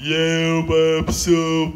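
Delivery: slow, thick, strange string voice; offended string speaking thick and odd; thicker, odd voice. Acted. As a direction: sulk it out as an upset string. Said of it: "mai gros și mai ciudat".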